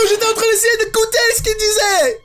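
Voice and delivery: high-pitched exasperated scolding; indignant scolding. Pretend. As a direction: scold her like an offended prude. Scolding game character Emily. (indignation outrée)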